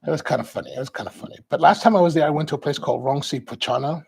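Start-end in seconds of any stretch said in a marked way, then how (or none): 0.7-1.4 murmured afterthought; soft muttered aside to himself; quiet echo. Real, not acted. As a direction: repeat it much softer, to yourself. Quieter echo of his own remark.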